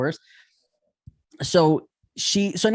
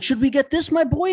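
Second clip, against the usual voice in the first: showy dad; 8 semitones higher than usual. Retold or imitated. Dad voiced spoiling his kid.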